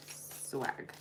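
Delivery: Swag breathed as a whisper; whispered nickname. Acted. Reader teasing Sagittarius with a whispered nickname. Nickname tag at sentence end.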